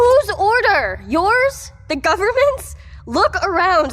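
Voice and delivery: indignant shouting at the bully; angry outburst. Acted. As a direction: fire each question back at him. Heated argument with official.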